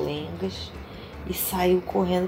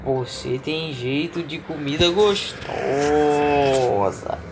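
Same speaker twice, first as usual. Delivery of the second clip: hungry cow; 3 semitones lower, slower than usual. Acted. Mother voicing the hungry cow, drawn out. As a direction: savour the word gostosa. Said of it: "disse a vaca".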